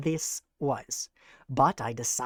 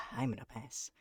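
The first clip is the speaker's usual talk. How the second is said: whispering; 4 semitones lower, 10 dB quieter than usual. Real, not acted. Passing on a chat offer.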